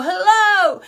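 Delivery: high, loud hello to the viewers; sunny hello; cheery hello. Real, not acted. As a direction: greet them brightly. Camera back in view.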